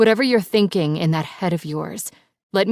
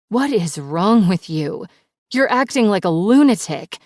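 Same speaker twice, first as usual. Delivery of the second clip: louder, higher furious yell; yelling back; 4 semitones higher, 8 dB louder than usual. Acted. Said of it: "I yell back".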